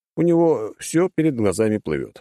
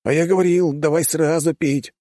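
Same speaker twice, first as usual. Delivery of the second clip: put-on reproachful young man; indignant; indignant son; 8 semitones higher than usual. A narrator's reading. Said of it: "возмутился сын".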